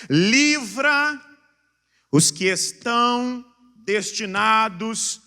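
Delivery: slow, loud proclamation; declaimed scripture. Real, not acted. Preacher reading a very serious verse to congregation, loud declamation. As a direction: hammer out the verse word by word. Reads the verse aloud forcefully.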